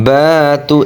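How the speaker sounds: "বাতু" with the first vowel held long; one-alif elongation. Shown as a demonstration. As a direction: stretch the "বা" in "বাতু" in measured recitation. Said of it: "এক আলিফ টান হবে".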